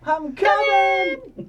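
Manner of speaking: high, long drawn-out holler; loud, high, dragged-out playful call; echoed call-out. Acted. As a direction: stretch it into a slow mock yell.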